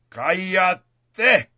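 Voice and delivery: high, loud commanding shout; authoritative shout. Acted. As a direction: bark the order like Jesus rebuking a demon. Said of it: "clamó con una voz autoritativa". (grito autoritario, fuerte y agudo)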